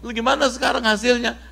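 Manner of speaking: high, fast and eager asking; eager question. Acted. Friends eagerly asking Abu Musa what he read, high and quick. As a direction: press him for the answer, curious and quick.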